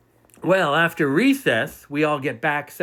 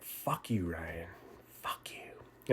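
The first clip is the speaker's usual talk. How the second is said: much softer, whispered triumph; gloating whisper; 11 semitones lower, 16 dB quieter, much slower than usual. Retold or imitated. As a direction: whisper it to the stolen prize, smug. Gloating alone at home.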